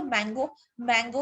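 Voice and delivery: ordinary talk, nothing marked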